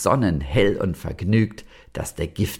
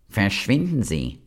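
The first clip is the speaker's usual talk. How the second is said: venomous; 3 semitones higher than usual. A narrator's reading. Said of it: "sagte giftig".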